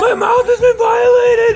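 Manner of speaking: high, loud outraged shout. Real, not acted. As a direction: yell it in sour outrage. After sourest candy.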